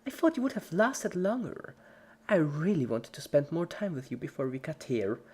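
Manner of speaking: high, sulky disappointment; creepy cheerful Pinkie. A narrator's reading. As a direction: scold her dying friend sweetly. Pinkie to dying Dash.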